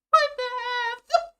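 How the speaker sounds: ordinary delivery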